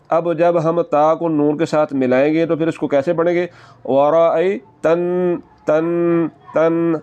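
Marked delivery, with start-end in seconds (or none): 3.9-7.0 तन with the न held through the nose; teacher demonstrating ghunna; nasal ghunna. Shown as a demonstration. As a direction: hum the न of तन. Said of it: "आवाज़ नाक में जाकर थोड़ा सा लंबा".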